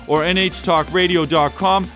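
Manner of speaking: usual speaking voice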